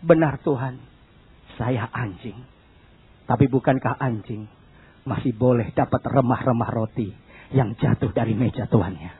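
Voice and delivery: very low, soft, halting answer; subdued, submissive murmur; humble woman's reply. Retold or imitated. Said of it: "Wanita bilang apa?".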